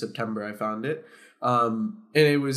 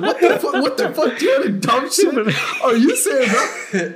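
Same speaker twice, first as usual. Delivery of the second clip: high, loud, laughing mock yell; cracking up while yelling at the seller; shrieking through laughter; laughing outrage; 11 semitones higher, 7 dB louder than usual. Retelling outraged reply, laughing.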